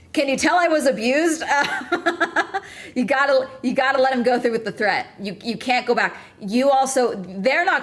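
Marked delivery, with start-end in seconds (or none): 0.1-4.2 laughing. Real, not acted. Dark joke, laughs through it.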